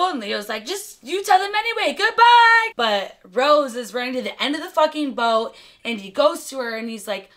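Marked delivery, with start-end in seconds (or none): 0.6-2.7 high excited call, building to a yell; shouted farewell. Retold or imitated. Leo waving to strangers from ship, shouted farewell. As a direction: call out to the crowd, louder on the last word.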